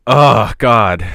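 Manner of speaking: loud exasperated groan. Real, not acted. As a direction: blurt it out, fed up. Exasperated at caller.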